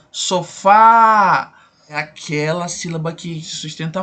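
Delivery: sofá drawn out long on its last syllable, fá held; stretched stress. Shown as a demonstration. Teacher showing students the stressed syllable, drawn out slowly. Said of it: "alongando ela".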